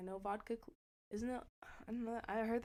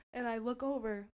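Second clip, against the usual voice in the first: normal talking voice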